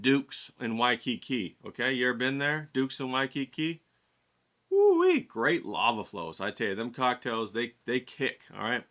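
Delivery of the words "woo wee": high, loud delighted whoop; excited whoop. Real, not acted. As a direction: whoop it up like a great memory. Recalling Hawaii cocktails, exclaiming.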